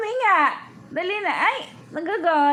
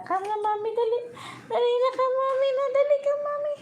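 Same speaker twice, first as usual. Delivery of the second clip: high-pitched, softer pleading to come; high coaxing call; 9 semitones higher, 4 dB quieter, much faster than usual. Real, not acted. Speaker calls a pet over to mommy, high coaxing. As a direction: coax sweetly in a rising high voice.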